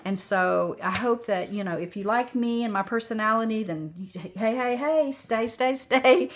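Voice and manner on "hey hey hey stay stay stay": higher, louder sing-song calls; bouncy louder call to stay; playful calling out. Acted. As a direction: coax the viewers to stick around.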